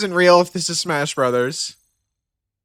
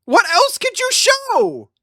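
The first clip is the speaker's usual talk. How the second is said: excited high squeal. Real, not acted. Excited reaction to surprise trailer.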